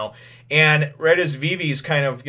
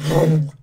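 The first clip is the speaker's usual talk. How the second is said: loud growly whoosh noise; imitated gator lunge; 5 semitones higher, 6 dB louder than usual. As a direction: make the noise of a lunging gator.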